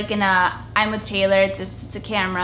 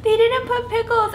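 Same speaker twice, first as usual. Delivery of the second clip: much higher, mock whimpering; whiny complaint; 13 semitones higher than usual. Kid at Subway whining about missing pickles, high. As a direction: whine it like a cheated kid. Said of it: "and I was like".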